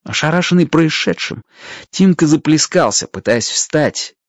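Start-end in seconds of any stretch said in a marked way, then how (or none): none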